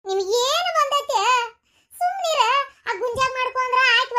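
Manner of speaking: high, slow exasperated scold; exasperated snapping. Acted. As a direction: cut him off up high. Wife snaps at drunk husband.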